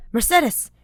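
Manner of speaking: loud, high anxious shout; panicked call. A narrator's reading. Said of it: "the panic in his voice".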